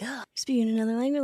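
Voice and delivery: quick, airy whisper of curiosity; hushed fascination. Acted. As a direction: whisper it quickly, intrigued by what you overhear. Acts own hushed fascination at overheard language.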